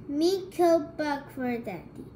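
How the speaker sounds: usual speaking voice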